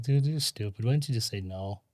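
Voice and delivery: inner voice. Retold or imitated. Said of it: "sit there for a minute and think".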